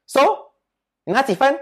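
严厉逼问的妈妈; stern mom. Retold or imitated. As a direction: demand the score like a furious tiger mom. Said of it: "Then she will be like".

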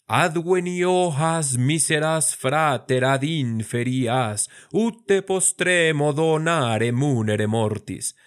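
slow, measured recitation of Latin verse; Latin verse recital. Shown as a demonstration. Teacher reciting Catullus to show long syllables. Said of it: "Catulo nos está demostrando".

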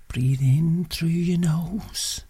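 slow whispered breathing guide; guided meditation. Acted. Breathing guidance.

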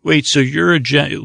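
ordinary talk, nothing marked

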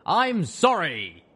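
loud, high and slow bellow. Acted. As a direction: bellow an apology. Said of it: "Lionheart bellowed".

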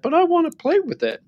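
put-on very high, wailing toddler plea; imitating a toddler's whine; whiny toddler. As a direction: pitch it up like an upset little boy. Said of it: "Oliver got really upset and was like".

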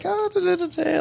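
put-on very high, light sing-song little girl voice; mock little girls. Mimicking the little girls trouncing around at the party, squeaky high sing-song. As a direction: prance through it like a tiny girl at play.

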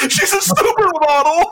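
very high, squeaky mock brag with laughs; mocking Gaetz. Host mocking Gaetz's bragging in a very high squeaky voice. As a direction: squeak out the boast like a teen. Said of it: "It's like".

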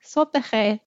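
a hint of a grown-up, mother-like voice; imitating her mother. Laura greeting workmen, lightly imitating her mother. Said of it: "سعی کرد صدایش را شبیه صدای مادرش کند".

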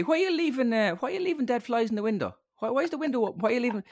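high, exasperated questioning of Mary; mock scolding. Imitated relative scolding Mary about dead flies, high mock exasperation.

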